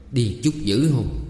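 slow, grumbled complaint; grudging grumble; grumbling. A narrator's reading. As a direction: grumble it, drawing the words out. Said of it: "còn lầm bầm".

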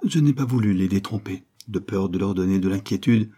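usual speaking voice

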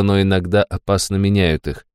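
ordinary delivery

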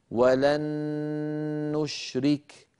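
"ولن نشرك" joined by one long humming n; doubled noon with ghunnah. Shown as a demonstration. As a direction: hold the n of "ولن" right into "نشرك". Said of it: "تحتاج إلى غنة أكمل".

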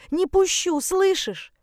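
very high, agitated mother; desperate insistence. Acted. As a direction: insist desperately, voice pitched right up. Mother struggles to keep son. (отчаянно, срываясь на высокий голос)

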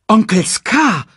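high, loud, slow insistence; determined. A narrator's reading. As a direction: address your uncle firmly as a cub. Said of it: "sagte Simba bestimmt".

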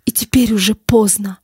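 breathless whisper, final; whispered despair. Acted. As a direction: whisper it, all hope gone.

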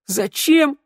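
very high, incredulous echo; mocking echo. A narrator's reading. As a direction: throw the question back in a high mocking pitch. Shnyryala mocks the question, rolls eyes. (передразнивая, возмущённо)

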